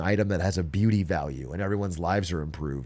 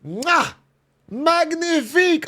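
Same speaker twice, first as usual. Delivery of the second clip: french chef; 3 dB louder, slower than usual. Pretend. Chef's kiss over bridge.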